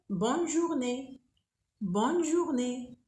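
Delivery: bonne journée slow, with the N of bonne clearly sounded; N pronounced. Shown as a demonstration. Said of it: "Ici, on prononce le N".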